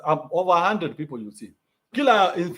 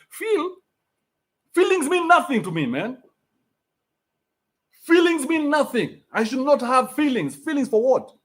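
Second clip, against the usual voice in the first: scornful rant; 7 semitones higher than usual. Real, not acted. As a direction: dismiss feelings with contempt. Scornful rant about feelings.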